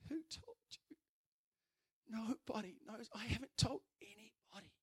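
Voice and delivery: retold tearful girl. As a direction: cry out the shock as the broken girl. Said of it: "collapsed to the ground in tears".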